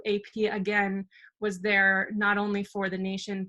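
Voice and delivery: ordinary delivery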